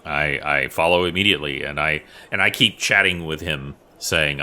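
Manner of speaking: ordinary talk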